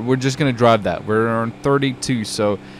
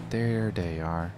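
drawn-out, softer and lower; slow low murmur; 6 semitones lower, 9 dB quieter, much slower than usual. Real, not acted. Gamer spotting his friends at the base, slow and soft. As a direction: savour it quietly, stretched out.

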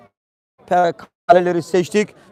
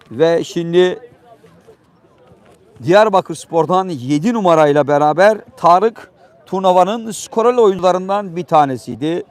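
ordinary delivery

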